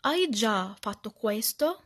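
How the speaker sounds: loud, slow practice repetition; repeating aloud. Shown as a demonstration. Teacher modelling how to repeat after the lesson, loud slow repetition. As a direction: repeat the Italian phrase slowly and firmly. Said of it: "اجي انا بقى اردد".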